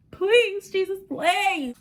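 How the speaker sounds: high, slow pleading prayer; hammed-up high plea to Jesus; desperate prayer. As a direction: beg Jesus in mock panic. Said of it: "I'm praying to God".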